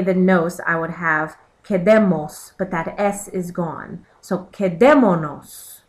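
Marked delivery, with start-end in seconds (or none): 4.5-5.8 "quedémonos" with DÉ punched louder; stressed verb syllable. Shown as a demonstration. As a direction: lean hard on the DÉ in "quedémonos". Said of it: "to the stressed syllable of the verb".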